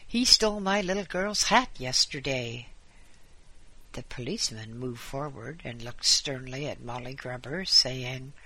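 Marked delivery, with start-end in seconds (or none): 0.1-2.7 loud, high angry accusation. A narrator's reading. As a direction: hurl the charge at him angrily. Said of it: "said the woman angrily".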